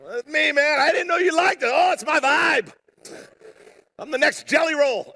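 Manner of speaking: high-pitched, hammed-up cool guy; put-on cool bravado. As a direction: play along as if it's totally your style. Acts sheepish reply posing as cool.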